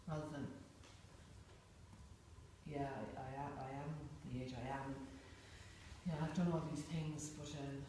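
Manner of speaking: a quietly deflated, unsure self; unsure inner voice. Retold or imitated. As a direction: mumble it like someone who doubts themselves. Contrasting the confident self with an unsure self.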